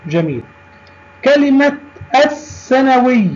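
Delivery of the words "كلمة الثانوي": الثانوي with silent lam and doubled first consonant; high teacher demo, no l in الثانوي; sun lam. Shown as a demonstration. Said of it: "أنها لام شمسية". (نطق «الثانوي» بلام صامتة وحرف مشدد بعدها)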